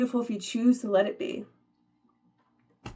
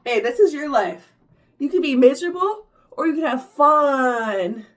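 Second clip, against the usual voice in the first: upbeat self pep talk; 4 semitones higher, 6 dB louder than usual. Retold or imitated.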